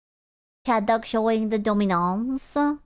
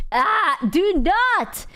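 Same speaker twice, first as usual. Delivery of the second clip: disgusted outcry; 7 semitones higher, much slower than usual. Real, not acted. Dog farts on her.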